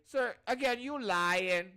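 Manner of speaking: high, loud mock student complaint; protesting student. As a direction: imitate a student calling out the teacher in accented English. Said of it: "say it in the same accent".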